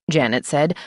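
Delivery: ordinary delivery, nothing marked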